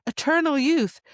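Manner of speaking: a mocking king's high, bright pitch; smug king. Retelling the king's offer of eternal youth, mocking high voice. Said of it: "the king's like".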